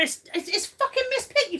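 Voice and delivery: high, put-on Miss Piggy with a German accent. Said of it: "Miss Piggy sounds like that".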